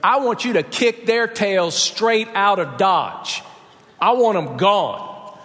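slow, deliberate ultimatum, lightly acted; Sarah's demand. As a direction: spell out the order slowly. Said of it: "she says to Abraham".

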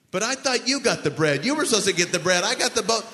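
loud, high-pitched blaming outburst; mock freak-out. Disciples ignore the warning and squabble over bread, mock panic. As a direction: burst out bickering like panicked disciples. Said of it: "they're all freaking out".

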